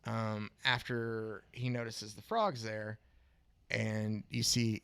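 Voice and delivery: usual speaking voice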